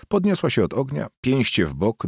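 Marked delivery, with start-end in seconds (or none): none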